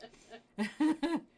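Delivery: loud burst of laughter; shared loud laugh. Real, not acted. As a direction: let out a hearty laugh. Shared joke about gifts.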